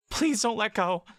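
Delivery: put-on high, pleading small girl's voice; a child elf begging not to be let go; frightened little girl. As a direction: beg like a scared child clinging on. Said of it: "she says / The voice is still Bella's".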